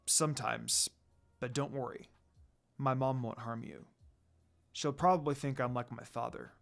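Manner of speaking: a reserved lord opening his heart quietly; tender confession. A narrator's reading. Sesshomaru reassures Kagome.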